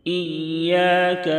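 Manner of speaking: slow, high, melodic tajweed chant; slow melodic Quran recitation. Shown as a demonstration. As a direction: draw each vowel out in a sung recitation. Recites verse.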